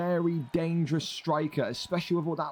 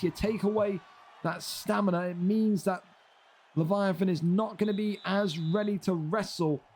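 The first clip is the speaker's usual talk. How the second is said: usual speaking voice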